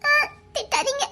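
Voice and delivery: high, tearful plea with a half laugh; on the verge of tears. Acted. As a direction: beg him to stop scolding, close to crying. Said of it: "அழுதுருவேன்".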